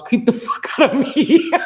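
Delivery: words breaking into a laugh. Real, not acted. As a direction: let the laugh burst through mid-phrase. Laughing about creepy dolls.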